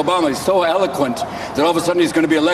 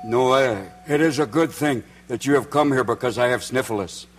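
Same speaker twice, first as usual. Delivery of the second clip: put-on Eskimo accent; 7 semitones lower than usual.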